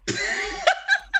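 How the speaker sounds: real high-pitched laugh; high burst of laughter. Real, not acted. Mother laughing at son's dramatic exit, high laugh. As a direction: laugh out loud at the memory.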